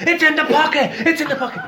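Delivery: panicked friend. Retold or imitated. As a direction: squeak it like the delirious patient. Friend voiced high, frantic.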